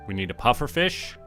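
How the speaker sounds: ordinary delivery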